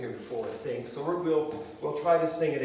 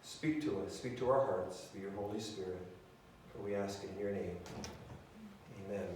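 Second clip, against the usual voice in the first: low, soft prayer voice; 5 semitones lower, 6 dB quieter than usual. Acted. Pastor praying over the congregation, soft and low. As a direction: pray quietly and humbly. Closing prayer before the sermon.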